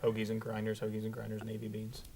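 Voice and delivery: soft, lightly voiced Adam Sandler mumble; Adam Sandler quote. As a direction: murmur the Sandler line quietly. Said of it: "Adam Sandler quote".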